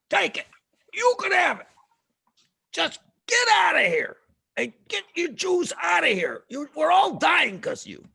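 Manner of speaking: high loud fed-up shout; exasperated neighbor. Retold or imitated. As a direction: shove them out the door with your voice. Neighbor hands over gold.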